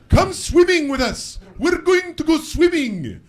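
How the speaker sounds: high, slow put-on boyish voice; dream boys' invitation. Comic voices Italian boys in a dream inviting him, high and singsong. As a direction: call out high and drawn out, luring.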